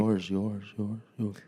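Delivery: playful echo trailing off to very quiet; fading echo. Pretend. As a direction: let the repeated word fade away. Echoing into the listeners' heads.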